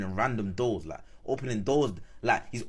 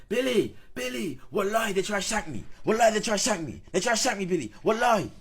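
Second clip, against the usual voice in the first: mock panicked scream; 11 semitones higher than usual. Friend running up yelling he was nearly stabbed.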